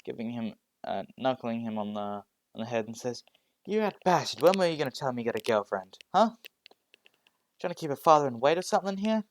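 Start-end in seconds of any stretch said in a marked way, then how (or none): 3.7-9.2 loud, fast, higher teasing rant; mock scolding father. Father teasing his son about a secret girlfriend, loud and fast. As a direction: rib him loudly like a nosy dad.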